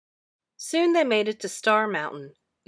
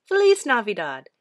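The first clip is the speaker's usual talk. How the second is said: louder, level holiday greeting; friendly train farewell; 2 semitones higher, 8 dB louder than usual. A narrator's reading. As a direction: say goodbye warmly as you puff away. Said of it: "Azul replied".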